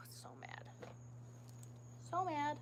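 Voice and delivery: breathy whisper of anger; whispered fuming. Real, not acted. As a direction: whisper it through frustration. Fuming quietly.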